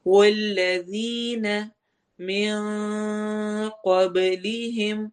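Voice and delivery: slow chant with a hidden nasal n; slow Quran recitation. Shown as a demonstration. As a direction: chant من قبلہم hiding the n. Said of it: "نون کی آواز کو چھپا کے". (نون چھپا کر تلاوت)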